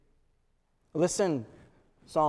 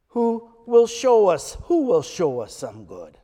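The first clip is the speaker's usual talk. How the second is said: mocking; 8 dB louder than usual. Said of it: "They're mocking".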